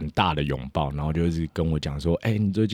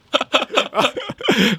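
loud high laugh that falls away; burst of laughter; 5 dB louder than usual. Real, not acted. Speaker cracking up retelling the backstage story, loud laugh. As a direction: laugh at the memory.

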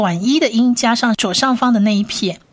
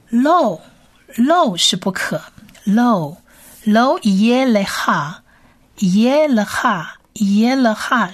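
slow drilling; much slower than usual. Shown as a demonstration. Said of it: "多念几次".

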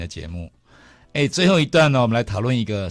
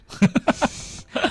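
high rising laugh. Real, not acted. Host laughs at radio joke.

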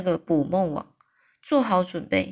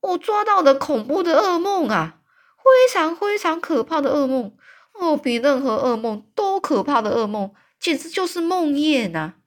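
high, loud shout of horror; 8 semitones higher, 5 dB louder than usual. A narrator's reading. Giant tells Sophie he caught a nightmare, horrified shout. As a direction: bellow how awful the nightmare is. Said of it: "这大巨人大叫说".

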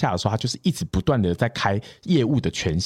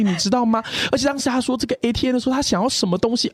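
higher, fake wounded innocence; pitiful victim act; 10 semitones higher than usual. To the boss's daughter on the phone, acting pitiful and wronged. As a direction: play the poor wronged girl to win sympathy. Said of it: "发挥我所有的声音表情".

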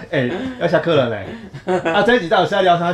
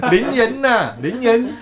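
林园呐 with a gliding tone, higher pitch; mimicked Linyuan accent; 6 semitones higher, much slower than usual. Classmate from Linyuan mimicked, sliding 林园. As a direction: copy the Linyuan slide on 林园. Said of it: "他就会讲".

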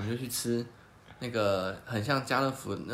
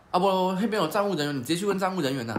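goading tout; 6 semitones higher, 4 dB louder than usual. Retold or imitated. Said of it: "阿北就是用激将法".

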